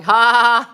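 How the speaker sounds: loud mock guffaw; big put-on "ha" laugh; imitated laugh. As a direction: imitate someone laughing at danger. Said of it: "like this, you know, they're laughing".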